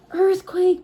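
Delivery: high, loud alarm cry; loud, high scream of warning; panicked shout. Acted.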